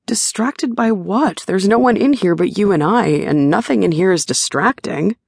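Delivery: lightly voiced confused partner; baffled, reasoning it out; perplexed Shane. A narrator's reading.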